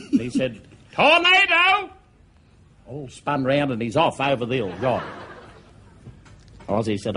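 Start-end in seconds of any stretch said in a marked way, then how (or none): 0.9-1.9 high, loud yell of Tornado; mock alarm cry; shouted Tornado. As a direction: bellow it to make the squad turn. Soldier shouting diversion word.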